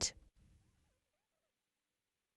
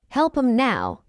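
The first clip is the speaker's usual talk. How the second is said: quick, linked "Help 'em now"; fast English; 2 semitones higher than usual. Shown as a demonstration. Teacher trains learners' ears, fast. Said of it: "fast English is not difficult".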